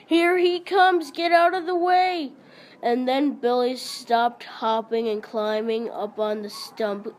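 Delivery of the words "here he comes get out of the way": higher, louder panicked yell. A narrator's reading. Boys running up the hill yelling a warning.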